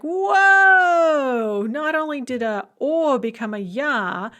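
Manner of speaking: teacher faking astonishment at the noun; mock amazed whoa. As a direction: act stunned by the stress shift. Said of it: "We're like".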